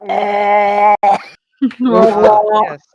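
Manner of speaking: booming loud exclamation. Acted. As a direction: proclaim it grandly. Loud mock pronouncement.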